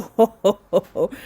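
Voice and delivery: string of breathy ho-ho laughs; amazed laugh. Real, not acted. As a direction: laugh it out after the whoa.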